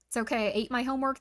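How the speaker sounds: ordinary delivery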